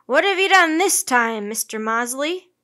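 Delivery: captain's loud scolding shout. A narrator's reading. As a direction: shout it as an accusing question.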